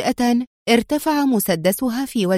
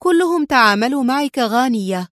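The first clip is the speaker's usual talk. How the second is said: ordinary delivery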